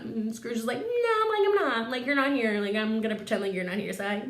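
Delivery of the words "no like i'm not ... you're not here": mock stubborn refusal. Scrooge stubbornly arguing back.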